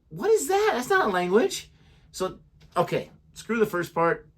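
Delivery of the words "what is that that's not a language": high, loud mock incredulity; mock disbelief. Speaker reacting to heard gibberish, mock disbelief. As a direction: act baffled, pitch way up.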